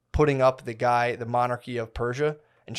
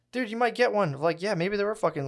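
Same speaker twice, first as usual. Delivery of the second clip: mocking high voice; 9 semitones higher than usual. Mocking credulous people.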